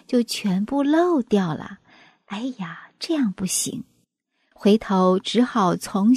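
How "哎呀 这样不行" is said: hushed, softer sigh of dismay. A narrator's reading. Friends finding the scent leaked away, soft dismay.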